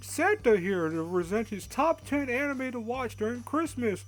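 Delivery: jolly high Santa, slow and booming. Acted. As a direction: play a hearty Santa Claus. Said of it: "I'm gonna stop doing that voice".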